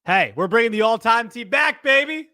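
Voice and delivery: high, loud hype-man call; hyped. Real, not acted. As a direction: pump up the listeners. Hyped announcement.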